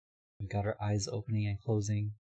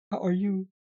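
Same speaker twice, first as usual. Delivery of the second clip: pitched up and louder, bright hello; high excited greeting; 9 semitones higher, 6 dB louder than usual. Acted. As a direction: greet them with a big lifted voice.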